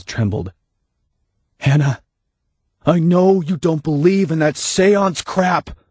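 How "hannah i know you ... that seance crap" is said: high, slow urgent plea; pleading Dave. Acted. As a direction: try to reason with her from below.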